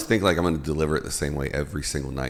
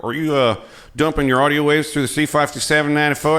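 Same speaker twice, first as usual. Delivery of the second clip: mock techy audio-nerd question, higher and louder; real audio guy; 9 semitones higher, 5 dB louder than usual. Imitating an audio guy quizzing a podcaster. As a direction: rattle off jargon like a know-it-all sound engineer. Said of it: "they're like".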